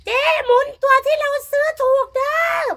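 high, loud call to be heard at the lottery draw. Retold or imitated. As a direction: yell across the crowd to Jinda. เฮ็ดปากอุ้มมือปากเราไว้.

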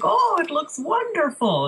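high, slow, lilting doctor impression; imitating funny accented doctor. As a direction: gush it in an unplaceable doctor's accent. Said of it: "I don't know what kind of accent he has, but he's really funny".

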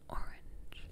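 fully voiceless whisper, close to the ear; whispered test word. Pretend. Doctor whispering a test word into the patient's ear. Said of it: "I'm gonna whisper something to you".

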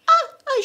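high, loud imitation shriek; mock scream. Retelling the housemate's reaction to the news, mock screaming. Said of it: "she's screaming".

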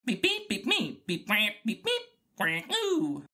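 high, bleating robot beeps and wails; droid beeps. Acted. R2 protesting his message, whining droid beeps. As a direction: whine and chirp like a fretful droid.

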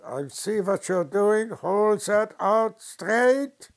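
loud, slow barked order; stern shout. Retold or imitated. Uncle snaps at the tired boy, loud and slow. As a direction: call him out, slow and loud. Uncle catches arm wavering.

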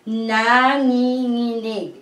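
"nanginginig" slowly, drawn out as na, ngi, nginig; "nanginginig" in slow, careful syllables. Shown as a demonstration. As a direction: stretch each syllable of "nanginginig" for learners. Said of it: "when you go by syllable".

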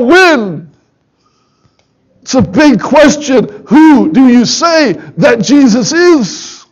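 rising, fervent sermon voice, pleading with the church; full-force preaching. Acted.

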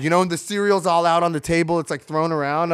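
ordinary talk, nothing marked

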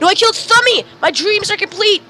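high-pitched gloating cheer; thrilled high squeal; excited triumph. Real, not acted. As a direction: crow over the victory, voice way up. Celebrating a kill in game.

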